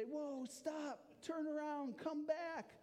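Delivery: high-pitched warning cry; acted yell of alarm, not full volume; urgent yell. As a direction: call someone back from danger, pitch way up. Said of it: "So you would yell, right?".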